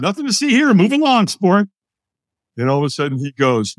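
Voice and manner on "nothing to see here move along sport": high, breezy mock brush-off; fake-fine. Hider telling the stranger to move along, fake-fine. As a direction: wave them away, pitched up and casual. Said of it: "when we're not, what do we say".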